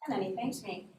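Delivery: plain reading voice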